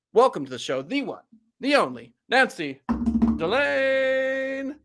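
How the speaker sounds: big build-up to a high Delane; announcer intro. Acted. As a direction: introduce her like a showman. Host introducing the guest.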